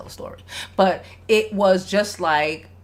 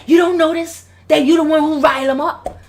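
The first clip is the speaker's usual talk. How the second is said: higher, raised dad voice; voicing the dad; 7 semitones higher, 6 dB louder than usual. Father confronting his wife about her son, raised voice. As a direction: plead the dad's case with heat. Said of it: "you know, he said".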